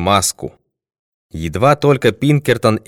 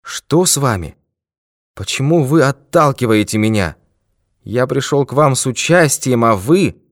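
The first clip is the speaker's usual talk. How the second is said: bewildered; 6 semitones higher than usual. A narrator's reading. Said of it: "Он в недоумении отступил на шаг".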